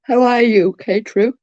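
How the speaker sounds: words pulled out through a yawn. Real, not acted. Greeting viewer K-True on a livestream mid-yawn. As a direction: greet them in the middle of a big yawn. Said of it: "Sorry for the yawn".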